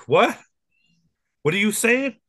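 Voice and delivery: dad quoting his daughter's baffled what; confused daughter. Retold or imitated. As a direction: play the daughter who can't follow her dad. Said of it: "And she's like".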